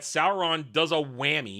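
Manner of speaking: normal talking voice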